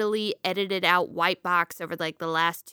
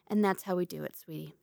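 much softer, smug murmur to her husband; smug quiet aside; 3 semitones lower, 8 dB quieter than usual. Retold or imitated. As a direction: gloat softly as you walk off. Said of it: "And she goes".

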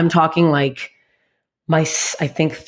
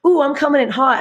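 much higher, smug and confident; cocky; 9 semitones higher than usual. Retold or imitated. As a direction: strut it out. Cocky past self.

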